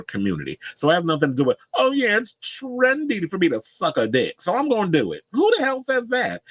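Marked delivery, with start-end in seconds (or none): 1.7-5.2 sneering high-pitched impression of a clueless claim; mocking quote. 5.3-6.4 incredulous. Real, not acted. Outraged at the mocked claim.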